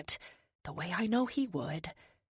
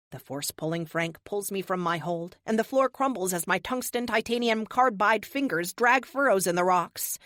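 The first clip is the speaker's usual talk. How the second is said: plain reading voice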